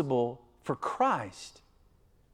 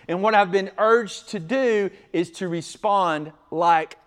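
raised, louder pulpit delivery; emphatic preaching; 5 semitones higher, 9 dB louder than usual. Real, not acted. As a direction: drive each phrase home to the congregation. Preaches at full force.

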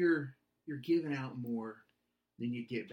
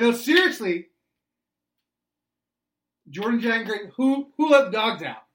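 put-on yelling at the kids; 7 semitones higher, 13 dB louder than usual. Dad yelling at kids again.

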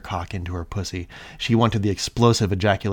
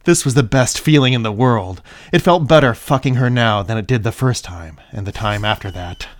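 story narration; 4 semitones higher, 10 dB louder than usual. Acted. Reading erotic story aloud, heightened.

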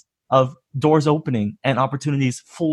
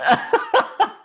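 high, breathless laughing fit; a real laugh bursting out; burst of laughter. Real, not acted. Host cracks up at story.

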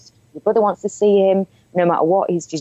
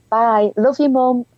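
teasing singsong; 7 semitones higher, slower than usual. Retold or imitated. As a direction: sing it out to embarrass mum. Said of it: "messing about, trying to embarrass her".

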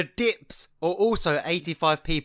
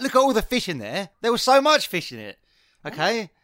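raised, indignant protest; retold furious chef; 4 semitones higher, 4 dB louder than usual. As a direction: defend your dish like an offended chef. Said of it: "the chef was furious".

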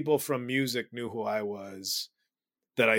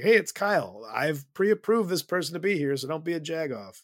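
raised announcer call; announcer voice from offstage; 6 semitones higher, 6 dB louder than usual. Retold or imitated. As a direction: announce it from off stage. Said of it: "announce from off stage".

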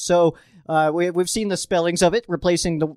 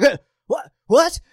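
rising baffled "what", last one louder and higher; mock confused fans; 7 semitones higher, 5 dB louder than usual. Rams fans reacting to his answer, mock bafflement. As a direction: play the outraged, confused fan. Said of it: "then Rams fans are like".

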